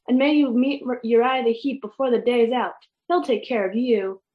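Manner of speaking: usual speaking voice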